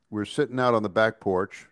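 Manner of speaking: ordinary talk, nothing marked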